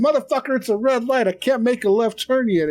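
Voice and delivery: half-yell it like a stuck driver; mock exasperated yell. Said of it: "You're like".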